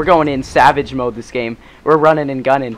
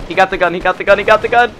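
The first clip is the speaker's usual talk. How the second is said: alarmed shouting; 6 semitones higher, 6 dB louder, faster than usual. Real, not acted. Enemy got the gun.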